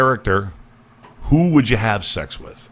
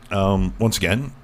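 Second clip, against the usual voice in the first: usual speaking voice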